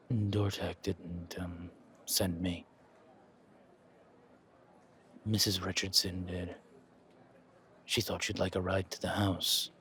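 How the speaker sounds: low, soft, distant driver voice; quiet, formal hired driver; reserved chauffeur. Acted. Chauffeur greeting his passenger, low and soft.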